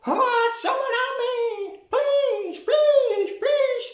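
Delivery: high, pleading, hammed-up cries; mock girl screaming. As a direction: beg for help in a squeaky, mocking kid voice. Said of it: "of course she'd be screaming".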